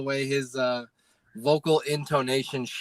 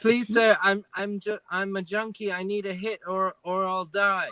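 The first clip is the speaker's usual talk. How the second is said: higher, stammering mock plea; pleading junkie; 5 semitones higher than usual. Junkie begging sir for a hit, mock pleading. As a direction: beg for a hit in a put-on higher voice.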